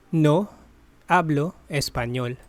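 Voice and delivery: normal talking voice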